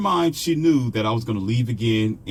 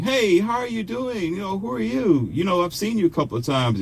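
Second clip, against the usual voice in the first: pretending to be strangers; 11 semitones higher than usual. Woman greets him as if barely acquainted, high put-on casual. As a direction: sing out a feigned first hello, pitched way up. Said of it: "she pretend like".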